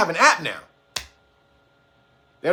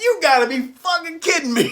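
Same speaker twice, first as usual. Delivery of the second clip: laughing quote; 8 semitones higher than usual. Retold or imitated. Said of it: "He was like".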